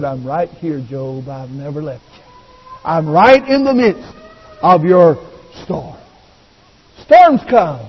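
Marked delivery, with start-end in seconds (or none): 0.0-6.0 low, hushed comforting voice; tender reassurance. Acted. Preacher as God comforting grieving Job, tender and hushed. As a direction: drop low and soft, as if holding someone close. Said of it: "God just slipped His arm around and said".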